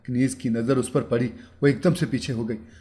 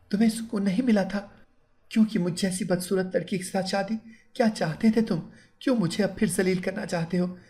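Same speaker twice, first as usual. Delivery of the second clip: higher, softer bitter bride, far from a real scream; hurt bride voicing hatred, pitched up; mild mock scream; 7 semitones higher, 3 dB quieter than usual. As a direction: bride hurls old insult back at groom, understated fury. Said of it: "वो चीख कर बोली".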